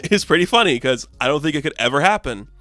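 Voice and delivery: ordinary delivery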